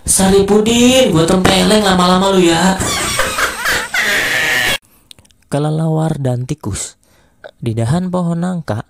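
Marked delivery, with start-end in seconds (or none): 0.0-2.8 loud, high furious mom shout; angry mother shouting at her daughter; mother yelling. Acted. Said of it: "Akhirnya Mama pun teriak". 2.8-4.8 high laughter. Acted. Laugh after punchline.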